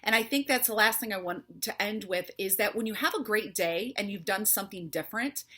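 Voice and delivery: ordinary talk, nothing marked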